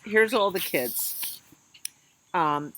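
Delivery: ordinary delivery, nothing marked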